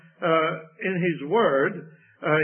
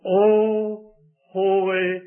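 drawn-out prayer; much slower than usual. Acted. Jesus praying to the Father.

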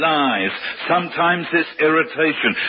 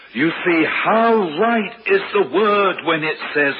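usual speaking voice

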